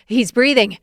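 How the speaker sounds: loud relieved yell. Acted. Bystander on the next court yelling the boy is breathing, loud. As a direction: call out that he can breathe again. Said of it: "somebody on the next court yelling".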